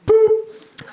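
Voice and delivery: high imitated PA beep; one high, short "boop" like an announcement chime; loudspeaker chime. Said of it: "we hear".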